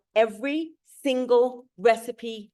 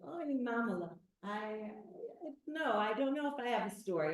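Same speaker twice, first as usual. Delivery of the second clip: put-on Eastern European accent; 3 semitones higher, 13 dB quieter, faster than usual. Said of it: "I do the worst Eastern European accent".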